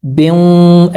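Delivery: बें slow, the sound held in the nose; nasal ghunna. Shown as a demonstration. Teacher showing students the ghunna on बें, slow and nasal. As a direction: hum बें's nasal ghunna slowly. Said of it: "ऐसे पढ़ना है".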